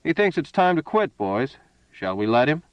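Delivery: a touch of gloating menace; mocking to his men. A narrator's reading. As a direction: play to the gang, sneering at the mark. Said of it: "his voice reached out directly at his companions".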